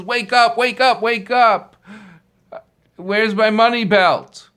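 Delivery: loud, high, frantic yelling to wake him; angry shouting. Acted. Owner shouting at the sleeping man to wake up. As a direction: shake him awake with a furious shout.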